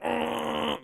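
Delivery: high strained groan; a choked-back high ugh; mock groan. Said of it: "You're like".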